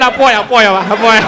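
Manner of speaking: loud, begging Luo repetition; mock pleading in Luo. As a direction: plead over and over.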